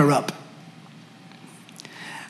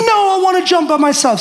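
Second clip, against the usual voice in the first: high, quick imitated child protest; toddler tantrum; 11 semitones higher, faster than usual. Daughter insisting to daddy, imitated tantrum. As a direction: whine back high and fast like a toddler. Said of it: "she threw a tantrum".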